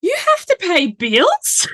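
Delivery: put-on high, slow, incredulous kid voice; seven-year-old son. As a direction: imitate a child's astonished question. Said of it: "He's like".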